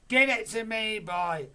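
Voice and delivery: very loud command; Grandma orders George, loud; bossy order. Acted. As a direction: demand the cup. Grandma demands.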